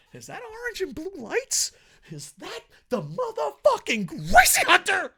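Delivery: very high, strained build to a loud climax; hyped disbelief. Acted. Reviewer spotting the Gracie Hunter on the card, hyped disbelief. Mock-amazed reveal of a wrestler.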